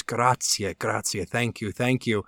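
softly voiced tearful thank-you, lump in the throat; a hint of a choked-up miner giving thanks. Miner thanking friends who paid his way home, softly tearful. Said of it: "He's tearful, he has a lump in his throat".

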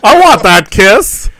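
high, loud swooning voice; gushing admirers. Retold or imitated. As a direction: swoon over the kiss. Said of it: "they're all like".

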